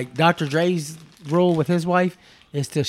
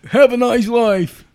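higher, louder, very drawn-out mimicry; mocking send-off; 7 semitones higher, 6 dB louder, much slower than usual. Imitating stranger brushing off an angry husband, mocking and drawn out. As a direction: exaggerate a slow, dismissive goodbye.

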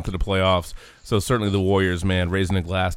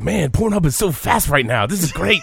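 lightly mocking, pleased cohost voice, higher; imitating cohost; 7 semitones higher than usual. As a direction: tease him with a gleeful put-on voice. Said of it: "he's probably like".

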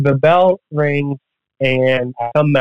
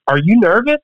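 high, urgent question from a nervous buddy; anxious friend; 9 semitones higher than usual. Retold or imitated. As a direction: blurt it out like you're panicking for him. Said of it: "He goes".

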